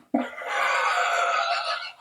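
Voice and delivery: long voiceless laugh. Real, not acted. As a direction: laugh it out without voice. Laughs after his own point.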